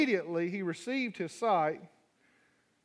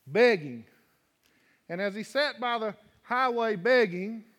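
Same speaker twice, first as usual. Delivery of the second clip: preaching; 4 semitones higher than usual. Acted. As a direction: read scripture building with fervor. Preaching the scripture reading.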